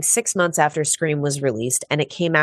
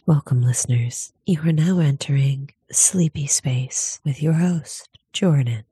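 sleepy whisper. Pretend. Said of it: "be like".

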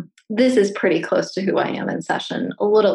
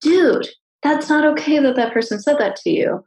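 animated, higher-pitched mock indignation; indignant urging; 7 semitones higher than usual. Therapist urging a passive client to get angry, animated and indignant. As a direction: urge them on, indignant on their behalf.

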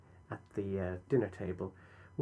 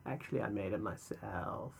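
soft, low creaky fry; vocal fry; 3 semitones lower, 7 dB quieter than usual. Acted. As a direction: keep it quiet and creaky. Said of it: "vocal fry".

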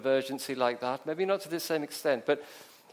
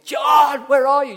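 panicked scream, much higher and louder; 11 semitones higher, 16 dB louder, slower than usual. Acted. Parent screaming for lost son on the beach, panicked yell. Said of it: "you're screaming".

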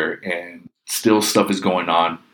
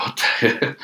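higher-pitched, mildly alarmed reaction; mock alarm, pitched up; retold freak-out; 7 semitones higher than usual. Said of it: "I'm just freaking out".

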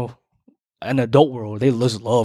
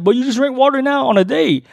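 incredulous; 12 semitones higher, 4 dB louder than usual. Retold or imitated. Questioning date about water.

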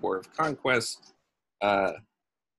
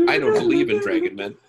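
Scully voice. Pretend.

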